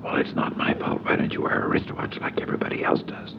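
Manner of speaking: hushed defensive whisper; whispered retort. Retold or imitated. Narrator scolding Howard in the funeral pew, whispered. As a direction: argue back without a sound.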